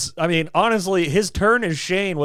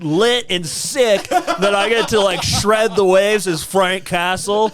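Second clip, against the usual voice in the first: surfer-dude impression, louder and higher; John Bernthal; 3 semitones higher, 3 dB louder than usual. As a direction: exaggerate a surfer dude's delivery. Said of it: "Sounds like a surfer".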